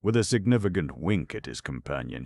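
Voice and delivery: ordinary delivery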